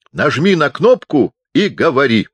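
high, loud command; loud instruction. A narrator's reading. Deaf chaplain hands Pupser the megaphone, instructing loudly. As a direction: instruct as if to someone far away.

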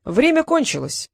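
high, slow proclamation; slow, triumphal declaration; solemn. A narrator's reading. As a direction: announce it ceremoniously. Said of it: "торжественно сказал дракон".

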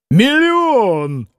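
high, exuberant cry; hearty guffaw. A narrator's reading. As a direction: burst out with it, slow and gleeful. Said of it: "захохотал Митя". (весело, будто хохоча)

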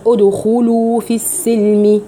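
ادخلوا said slowly, each u fully rounded; full damma. Shown as a demonstration. Teacher recites the verse with full rounding, slowly. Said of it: "ما كان ضم إلا لضم الشفتين".

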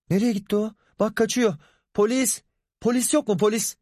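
high-pitched, urgent cry; alarmed calling, voice way up; calling for help. A narrator's reading. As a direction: call out for police as he escapes. Calling police as attacker flees.

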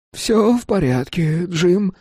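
feeble, slow and faltering; barely has the strength to speak; weak voice. Acted. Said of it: "сказал слабым голосом артист".

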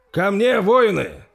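slow, loud, high rallying call; rally the scattered men; shouted command. Acted. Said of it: "прокричал принц".

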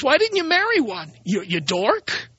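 usual speaking voice

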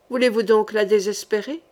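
ordinary delivery, nothing marked

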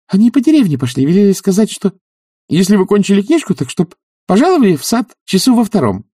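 put-on high-pitched girlish messenger; chirpy high servant girl; young maid. A narrator's reading. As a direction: deliver the message in a high, eager voice. Narrator voices the young maid Katya.

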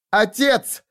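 high anguished cry of Father; a sudden high cry of recognition. Acted. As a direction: call out to him in shock. Daughter cries out to father.

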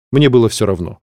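plain reading voice

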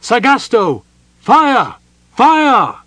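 loud, high order to fire; shouted command. Acted. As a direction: command the archers at full voice. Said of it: "she shouted in flawless Silvanesti Elven".

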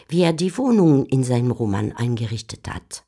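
ordinary talk

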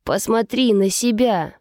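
plain reading voice

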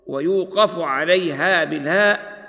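ordinary talk, nothing marked

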